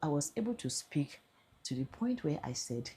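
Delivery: normal talking voice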